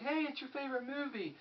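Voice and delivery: high, chirpy imitation of the girlfriend; girlfriend's voice. Imitating girlfriend handing over the Scarface tape, high and bright. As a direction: mimic her cheery gift-giving tone, pitched high. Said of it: "She's like".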